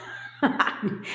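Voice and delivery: brief airy chuckle; short, breath-only laugh. Real, not acted. Coach jokes about keeping clients on track, short laugh.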